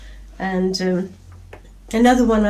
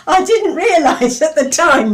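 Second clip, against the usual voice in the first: laughing; 8 semitones higher, 7 dB louder than usual. Real, not acted. Laughing at her own joke.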